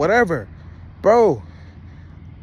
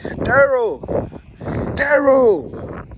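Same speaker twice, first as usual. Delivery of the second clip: high, drawn-out wail falling on the name; mock crying; 5 semitones higher, slower than usual. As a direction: imitate a hurt girlfriend wailing at her man. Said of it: "She's crying".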